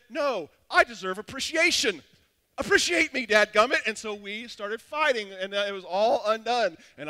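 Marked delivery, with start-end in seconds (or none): 0.1-3.9 louder, rising mock-wounded demand; hammed-up angry plea to be appreciated; indignant outburst. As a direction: demand credit like a sulking young husband. Husband's retold fight with wife.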